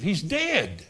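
usual speaking voice

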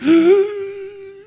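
loud, high icy-water gasp; imitated deep gasp. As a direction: suck in a big shocked breath from freezing water. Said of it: "She took a real deep".

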